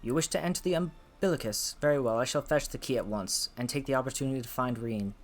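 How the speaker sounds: put-on a light Scottish accent for Lena; soft Scottish-accented voice for the character. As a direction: read her lines in a gentle Scottish brogue. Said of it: "Lena has a Scottish accent".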